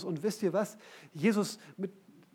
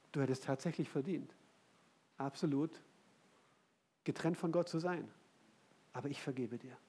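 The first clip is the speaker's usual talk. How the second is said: soft, lowered voice with weighty pauses; solemn forgiveness; 3 semitones lower, 8 dB quieter than usual. Acted. Jesus addresses the guilty woman, quiet and grave. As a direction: gently state the truth, then forgive.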